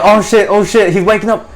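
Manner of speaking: high, loud comic alarm; retold panic. Marine feeling the bug move again, panicking. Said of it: "Here I am panicking".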